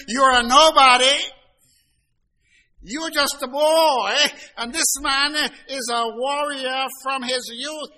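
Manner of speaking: put-on high, loud and dismissive, talking down to a boy; a sneering doubter dismissing the shepherd boy; scornful put-down. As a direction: belittle him as a nobody. People belittling the young David.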